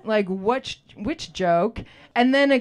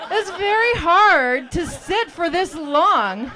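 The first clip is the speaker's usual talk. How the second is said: slow, higher, laughing complaint; laughing lament; 6 semitones higher, slower than usual. Acted. Naked model complains to the class, laughing. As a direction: whine it through laughter.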